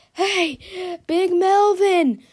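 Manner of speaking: high, loud, drawn-out call; breathless shout. A narrator's reading. George calling to Melvin, breathless loud shout. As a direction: call out across the field, winded from running. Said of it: "shouted George, huffing and puffing".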